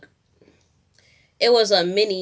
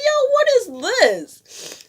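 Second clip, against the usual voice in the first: very high, slow, half-laughing; high panicked disbelief; 4 dB louder, slower than usual. Retold or imitated. Laughing panic as water breaks.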